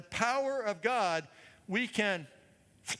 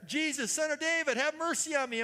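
higher and louder, calling over a crowd; desperate plea raised high and loud; crying out louder; 7 semitones higher than usual. Retold or imitated. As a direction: cry out to be heard above everyone. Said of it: "He, he cried out louder".

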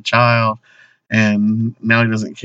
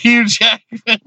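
laughing correction; 9 semitones higher, slower than usual. Real, not acted.

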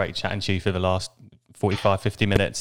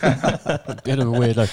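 laughing. Real, not acted. Host repeats guest's words, laughing.

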